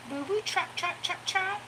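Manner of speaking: high playful trot chant; high sing-song coaxing. Real, not acted. Owner urging her dog to prance, high sing-song. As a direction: coax the dog along, way up high.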